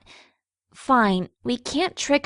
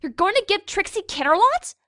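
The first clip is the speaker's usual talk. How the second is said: higher, disbelieving and exasperated; incredulous outburst; 6 semitones higher than usual. Acted. Twilight demanding an explanation, higher and outraged. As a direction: throw your hooves up in disbelief.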